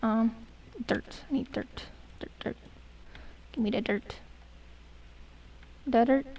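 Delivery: ordinary talk, nothing marked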